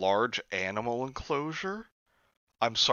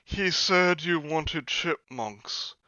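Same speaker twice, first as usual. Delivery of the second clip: higher, hummed put-on voice of Parker; Parker's voice; 6 semitones higher, slower than usual. Narrator voices Parker reporting the call. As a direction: voice Parker musing aloud. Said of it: "I asked Parker".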